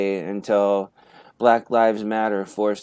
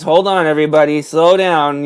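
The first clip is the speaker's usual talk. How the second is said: raised voice, higher and urgent; mock calling out; 4 semitones higher, 7 dB louder than usual. Police officer mockingly holding everybody back, calling out. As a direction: call out to halt a crowd, mockingly. Mimics police restraining others for gunman.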